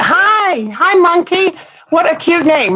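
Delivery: light up to greet a newcomer; excited greeting. Real, not acted. Greeting a viewer by name.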